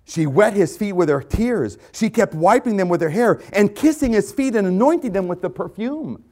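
high, loud, building insistence; impassioned preaching. Real, not acted. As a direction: hammer out each act with rising fervor. Preacher intensifies the weeping woman story.

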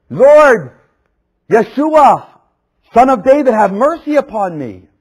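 high, loud desperate call; crying out. Acted. Blind man crying to Jesus for mercy, loud cry. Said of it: "He cried out even more".

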